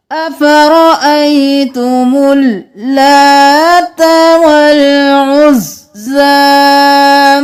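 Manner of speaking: slow, chanted tajweed recitation; Quranic recitation. Shown as a demonstration. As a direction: chant it slowly, stretching the vowels. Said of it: "वक्फ कर देंगे जा के साथ।".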